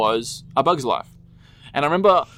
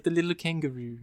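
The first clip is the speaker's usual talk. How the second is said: put-on higher, softer French accent; 5 semitones higher, 6 dB quieter than usual. Quoting the French chick from Pulp Fiction in a French accent. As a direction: imitate a cute French woman's accent. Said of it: "the way she says that with her accent".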